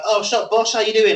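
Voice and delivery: high, bouncy, matey hello; over-friendly cheeriness; breezy greeting. Retold or imitated. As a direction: hail the cashier like an old pal. Acts out his breezy greeting.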